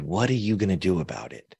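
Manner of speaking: soft, retold motherly question; calm challenge. Mom's question that stopped the crying, soft and calm. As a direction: drop the volume and ask it calmly. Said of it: "It just went calm".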